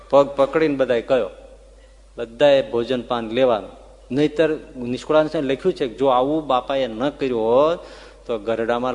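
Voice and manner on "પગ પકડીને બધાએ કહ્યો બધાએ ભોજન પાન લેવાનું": ordinary delivery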